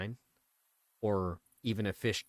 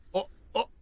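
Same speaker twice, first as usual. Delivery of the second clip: excited; 4 dB louder than usual. Real, not acted. Sudden bite on the line.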